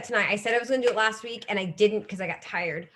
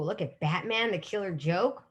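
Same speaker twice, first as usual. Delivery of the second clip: normal talking voice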